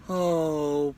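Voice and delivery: usual speaking voice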